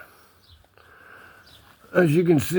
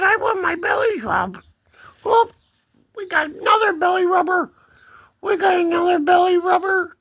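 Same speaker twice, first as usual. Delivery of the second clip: high-pitched eager kitty talk; cat voice. Pretend. Second cat demanding a belly rub, high cat voice. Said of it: "She says".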